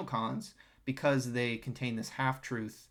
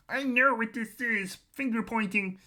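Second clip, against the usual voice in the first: mock crashing out; 10 semitones higher, 4 dB louder than usual. Streamer imitating the man who interrupted Candace, hammed-up and high. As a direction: exaggerate his outburst in a much higher voice. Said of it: "Now he's just crashing out".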